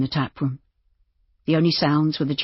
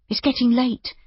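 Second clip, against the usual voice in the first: reasoning gently in a high woman's voice; higher voice, talking sense to him; reasonable; 8 semitones higher than usual. A narrator's reading. Innkeeper dissuading the doctor from leaving, reasonable. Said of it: "Judy said reasonably".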